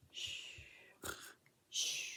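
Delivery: soft snore with a long hushing exhale; sleepy snore-and-whoosh; imitated snoring.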